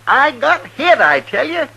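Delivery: high, loud, pleading insistence; desperate protest. Acted. Accused man insists he was struck.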